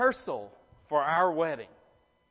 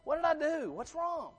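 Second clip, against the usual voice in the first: baffled groom; 9 semitones higher, 3 dB quieter than usual. Retold or imitated. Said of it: "I'm like".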